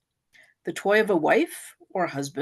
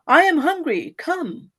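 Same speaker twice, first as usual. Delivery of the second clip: put-on child's crying plea; 6 semitones higher, 3 dB louder, slower than usual. A narrator's reading. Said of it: "Then he started crying".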